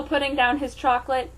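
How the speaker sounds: ordinary delivery, nothing marked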